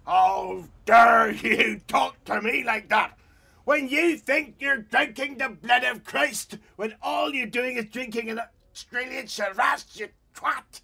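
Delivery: slow, high, loud bellowed insult; furious mock shout. Loyalist bellowing back across the street, slow and furious. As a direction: roar it back, hammed-up rage.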